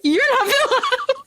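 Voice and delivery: high-pitched pretend shock; mock bewildered. She finds the subletter walking in, mock bewildered. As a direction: act out a stunned double-take, high. Mimics confronting the wrong man.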